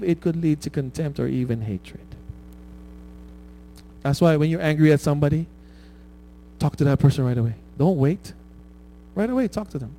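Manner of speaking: usual speaking voice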